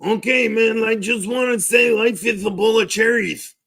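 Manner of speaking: high put-on character voice; Andrew Guzman. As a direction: keep it high and bobbing, a mocking impression. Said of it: "All right, fuck off, Guzman".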